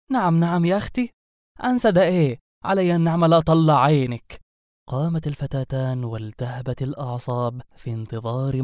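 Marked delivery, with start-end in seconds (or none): 0.1-4.4 higher-pitched, sassy indignation; indignant. Acted. Girl refuses to forget the insult, indignant threat. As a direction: square up to her, offended and threatening. Offended girl picks a fight.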